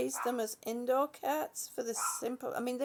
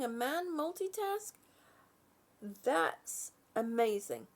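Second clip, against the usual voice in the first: ordinary talk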